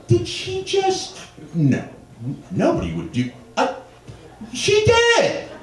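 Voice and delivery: very high, halting incredulity; stunned disbelief. Retold or imitated. Man scratching his head after the insult, acted disbelief. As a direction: work it out haltingly, then squeak in outrage. Stunned at being called reptile.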